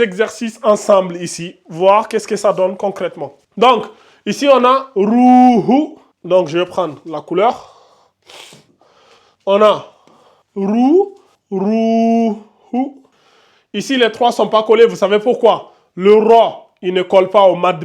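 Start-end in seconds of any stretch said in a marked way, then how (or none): 5.0-6.0 "rouhou" with a long first "ou"; voyelle allongée. Shown as a demonstration. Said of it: "on l'allonge en deux secondes". 10.5-13.0 "rou" held long, then "rouhou"; voyelle allongée. Shown as a demonstration. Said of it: "on l'allonge en deux secondes".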